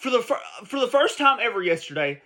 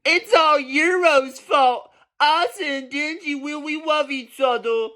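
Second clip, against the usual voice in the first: high, slow, teary mock whine of a fan; mocking whiny fan; 7 semitones higher, slower than usual. Mocking shippers who defend the ship, in a high teary whine. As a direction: cry it out like an obsessive shipper, hammed-up.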